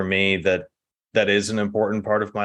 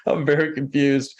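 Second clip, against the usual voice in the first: retold exasperation in a higher voice; frustrated bafflement; 5 semitones higher than usual. Explaining childhood frustration over his mispronounced name, mock-baffled. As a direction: act out a confused, frustrated younger self. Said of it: "it was frustrating for me".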